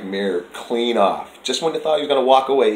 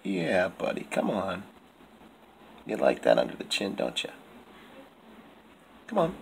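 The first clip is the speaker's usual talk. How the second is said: soft coaxing; 3 semitones lower, 10 dB quieter than usual. Real, not acted. Coaxing the cat from cage.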